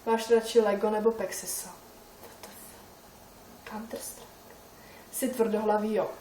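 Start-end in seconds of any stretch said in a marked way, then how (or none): 1.7-4.5 whisper. Real, not acted. Shocked whisper at a silly question.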